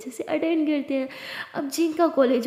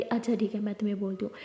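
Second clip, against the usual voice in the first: soft, lower aside; 4 semitones lower, 7 dB quieter, faster than usual. Acted. Lily softly starting to advise Diya. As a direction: lower and softer, settling in.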